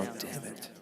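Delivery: normal talking voice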